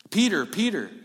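high, loud urgent call. Acted. Jesus speaks to Peter.

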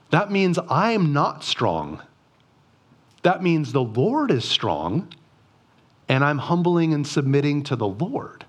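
ordinary delivery

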